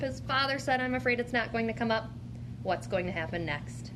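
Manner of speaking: ordinary talk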